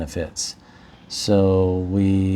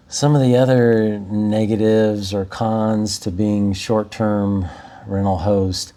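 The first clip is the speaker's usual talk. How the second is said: ordinary talk, nothing marked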